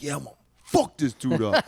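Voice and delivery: brash, swaggering self-talk; cocky inner voice. Retold or imitated. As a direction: psych yourself up with bravado. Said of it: "you're like".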